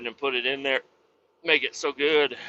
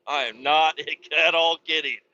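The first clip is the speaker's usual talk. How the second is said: ordinary talk, nothing marked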